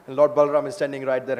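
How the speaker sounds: plain reading voice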